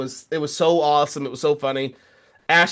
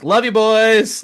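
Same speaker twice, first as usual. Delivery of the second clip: cheery sign-off; 6 semitones higher, 4 dB louder, slower than usual. Retold or imitated. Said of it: "we're always like".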